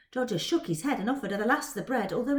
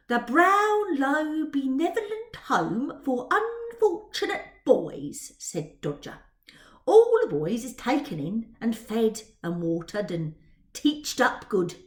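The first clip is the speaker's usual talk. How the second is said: louder, slow cockney urchin; Dodger's cockney voice; 6 dB louder, slower than usual. Acted. Dodger describing the boys' home to Twill, louder cockney sneer. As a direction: recite it like a sneering street boy.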